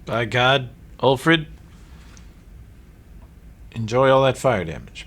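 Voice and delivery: loud, gleeful outburst; excited exclaim. Real, not acted. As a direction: relish the big fire hit. GM unleashes big fire spell.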